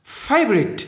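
"Favorite" high on the first syllable, then falling; nhấn âm đầu. Shown as a demonstration. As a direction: punch FA in "Favorite". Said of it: "nhấn âm đầu này". ("Favorite" nhấn cao âm đầu)

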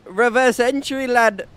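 loud, high, slowed announcement; hyped stunt call. Real, not acted. Gamer announcing a reverse entry stunt, loud and hyped.

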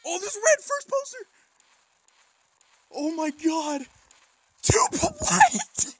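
real high, cracking excited squeal; squealing disbelief. Real, not acted. Another rare pull, overwhelmed.

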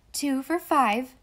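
loud, deliberate vendor's price; brisk-mannered but measured announcement; businesslike. A narrator's reading. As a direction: state it firmly and slowly, like a shopkeeper. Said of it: "in a businesslike tone".

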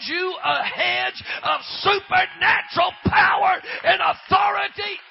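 high, loud, shouted preaching; preaching at full force. Real, not acted. Preacher calling the church up to the altar, full-force preaching. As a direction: drive the congregation to its feet.

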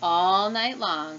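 ordinary delivery, nothing marked

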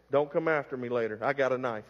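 hushed, teasing warning; mock threat aside. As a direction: mutter the joke under your breath. Joking threat aside after hair complaint.

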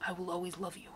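quiet loving murmur. Acted. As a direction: breathe it softly in her ear. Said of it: "he whispered in her ear".

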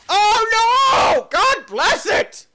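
very high, loud wail; explosive exasperated yell; anguished shout. Real, not acted. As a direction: scream in frustration at the crash. Dragon crashes.

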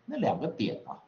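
usual speaking voice

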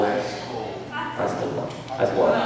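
slow, soft correction; quiet aside. Real, not acted. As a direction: murmur the correction slowly. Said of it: "warm water".

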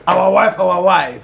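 exuberant hailing. Acted. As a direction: hail her with playful gusto. Hailing the friend's partner.

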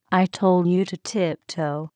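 usual speaking voice

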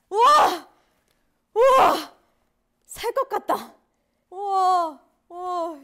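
loud, high cries of relief; relieved cheer. Real, not acted. Idol cheering after finishing a hard album, loud and high. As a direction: whoop like you survived.

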